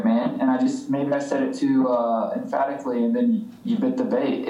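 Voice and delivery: ordinary talk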